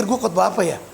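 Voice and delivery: low, amused inner voice; mock puzzlement with a laugh; wondering to himself. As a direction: drop low as if thinking aloud. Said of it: "saya mikir".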